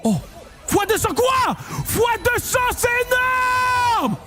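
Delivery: thrilled big-win announcer; ecstatic. Real, not acted. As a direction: pitch soaring high with excitement. Huge x200 win.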